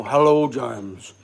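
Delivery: slow, low, rumbling greeting; slow deep-voiced impression of a friend; deep voice like Boris. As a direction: drop low and draw out the hello. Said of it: "Boris has that real deep".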